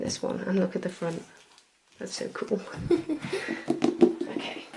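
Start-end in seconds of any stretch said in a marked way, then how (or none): 2.0-2.6 amused, laughing through it. Real, not acted. Delighted at the book cover. 2.7-4.2 breathy laugh. Real, not acted. Laughing at the book cover.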